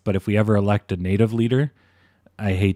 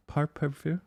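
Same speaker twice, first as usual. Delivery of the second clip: mock French accent, higher and hushed; 7 semitones higher, 9 dB quieter than usual. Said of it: "I can't do it".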